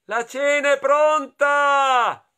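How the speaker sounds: loud, high, drawn-out call; mother shouting. A narrator's reading. Mamma calling that dinner is ready, long high shout. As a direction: yell it through the house, stretching the words. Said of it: "urlò la mamma".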